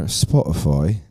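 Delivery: English accent. Shown as a demonstration. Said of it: "my English accent".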